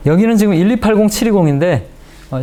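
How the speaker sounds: normal talking voice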